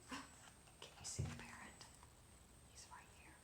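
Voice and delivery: hushed secret whisper. Real, not acted. Pointing out the hidden parrot to a child in a whisper. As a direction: whisper it so the parrot won't hear. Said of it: "whispering to child".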